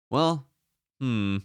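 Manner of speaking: usual speaking voice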